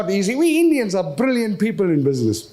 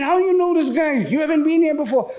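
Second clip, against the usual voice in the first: wife's voice; 7 semitones higher than usual. Retold or imitated. Wife confronts him, high voice.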